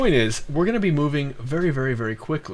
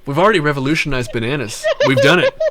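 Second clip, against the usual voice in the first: laughing; 5 semitones higher, 5 dB louder than usual. Real, not acted. Laughing banter.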